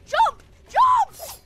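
high, loud urgent yell; shouted command. Real, not acted. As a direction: yell it at your teammate. Yelling orders to teammate.

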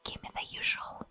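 high whispered customer demand. Acted. Customer in the cafe ordering, high whisper. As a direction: squeak it out like a cocky regular.

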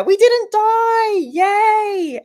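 high, loud squeal of relief; victory squeal. Real, not acted. Streamer squealing to chat after the ogre fight, high and loud. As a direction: squeal it out like you just cheated death.

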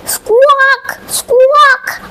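high, drawn-out squawks; happy parrot squawk. Acted. Parrot flies happily, high squawking. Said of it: "Polly the parrot flew happily".

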